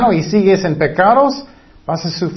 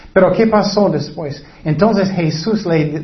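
ordinary talk

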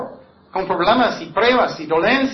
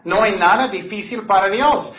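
ordinary talk

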